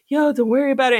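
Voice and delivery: a hint of a big brother reassuring; Keenen Ivory Wayans impression. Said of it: "impression of Keenen Ivory Wayans".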